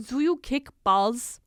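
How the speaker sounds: slow, loud fake German accent; deadpan fake German question. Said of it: "fake German interviewer".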